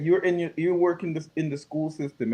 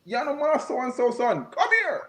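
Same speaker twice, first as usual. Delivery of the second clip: elder calling out; 7 semitones higher than usual. Retold or imitated. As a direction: call the kid over like a strict village elder. Village elder calling a kid over.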